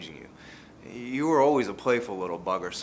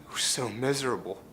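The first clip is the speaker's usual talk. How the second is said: ordinary talk, nothing marked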